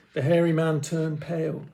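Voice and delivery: ordinary delivery, nothing marked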